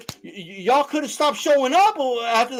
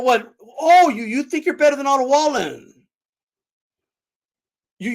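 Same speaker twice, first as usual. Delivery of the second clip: normal talking voice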